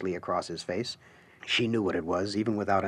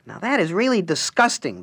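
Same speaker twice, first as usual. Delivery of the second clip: raised, prim voice of distaste; disgusted Harlequin; 9 semitones higher, 5 dB louder than usual. Acted. The Harlequin scoffing at pretty Alice's poster, prim disgust. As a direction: look down your nose at the poster. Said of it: "the Harlequin said".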